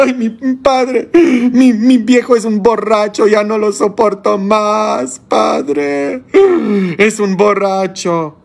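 imitating a tearful wife complaining; imitated crying woman. Wife complaining to the priest about her drunk husband, high mock weeping. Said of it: "toda llorando".

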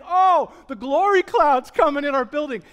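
mocking quote. Mocking hyped charismatic churchgoers.

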